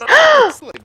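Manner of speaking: loud, high gasp of surprise; big sharp intake of breath; startled gasp. Real, not acted. Gamer spots Jimmy arriving, startled gasp.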